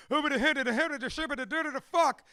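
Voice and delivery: fast, loud, high gibberish rant; mock inner freak-out. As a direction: babble frantically and end on a curse. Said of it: "when I feel myself saying".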